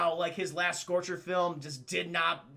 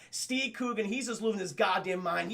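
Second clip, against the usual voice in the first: ordinary talk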